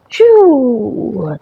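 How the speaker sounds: ordinary delivery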